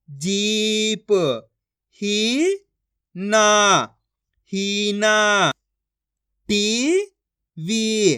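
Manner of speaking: each syllable stretched long and held; very slow syllable-by-syllable reading demo; drawn-out pronunciation. Shown as a demonstration. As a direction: draw out every vowel like a reading teacher. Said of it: "खींच खींच के".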